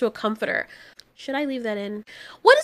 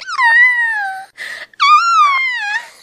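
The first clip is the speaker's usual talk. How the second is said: very high, loud fake scream of fear; mocking movie scream; 6 dB louder, much slower than usual. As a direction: parody a cheesy horror-movie shriek. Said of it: "and their scream is like".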